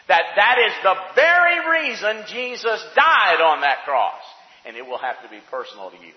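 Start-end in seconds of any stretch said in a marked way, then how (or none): 0.1-4.2 loud, high-pitched preacher's cry; full-force preaching. Real, not acted. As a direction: preach it with raised, urgent force.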